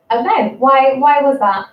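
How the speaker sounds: ordinary talk, nothing marked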